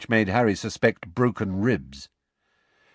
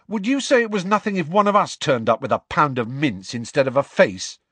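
raised, indignant challenge; demanding; 6 semitones higher, 4 dB louder than usual. A narrator's reading. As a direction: challenge him hotly. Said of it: "Ron demanded".

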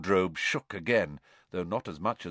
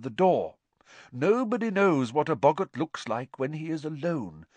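higher, measured classroom address; lively teacher character; 6 semitones higher than usual. Acted. Professor Lupin briefing the class about the boggart, lively teacher. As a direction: explain it vividly to a class. Teacher's lecture in narrated story.

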